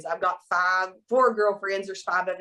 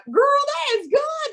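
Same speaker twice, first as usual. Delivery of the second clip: excited praise; 3 dB louder than usual. Retold or imitated. Praising her friend, big pitch jump.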